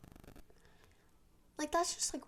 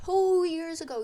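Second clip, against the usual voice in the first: exasperated; 7 semitones higher, 9 dB louder than usual. Real, not acted. Exasperated rant at the girl.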